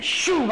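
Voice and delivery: high-pitched vocal zoom; imitated whoosh. Imitates truck running red light again.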